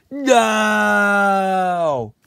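a sharp, loud cry of no; alarmed shout. Acted. A friend shouts in alarm as the power button is hit. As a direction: yell it out as everything goes wrong.

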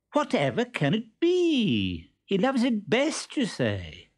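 high, slow, put-on wondering; loud Columbine. Columbine teasing the hidden Stentorello, high and slow. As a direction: play dumb loudly for the trunk. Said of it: "she said very loudly".

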